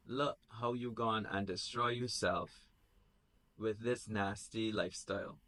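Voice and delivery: hushed disapproving reading; quiet reading. Acted. Jesus reads mother's email.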